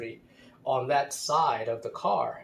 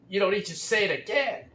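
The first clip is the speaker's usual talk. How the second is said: higher-pitched mock annoyance; imitated bitter retort; 7 semitones higher, 4 dB louder, faster than usual. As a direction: mimic his angry comeback, less forcefully. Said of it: "he said really angrily".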